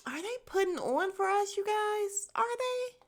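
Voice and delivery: a playful, sarcastic lilt; mock incredulous. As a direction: needle them with a high mock-innocent ask. Sarcastic aside to her viewers.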